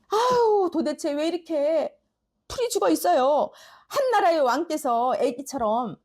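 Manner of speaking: acted exasperated wife; coaxing queen. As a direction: chide a sulking husband, starting high on the sigh. Queen coaxing her sulking king.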